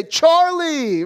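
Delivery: high, drawn-out mock hail; over-familiar greeting. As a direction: call out to a king like an old pal.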